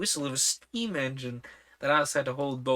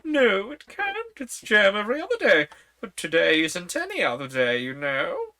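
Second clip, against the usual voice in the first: put-on high, fussy old queen; 6 semitones higher, 6 dB louder than usual. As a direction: talk up high like a flustered queen.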